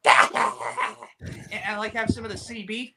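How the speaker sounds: high, slow ordering voice; a hint of a slow, high-voiced customer; lightly acted customer. Customer in acted restaurant bit.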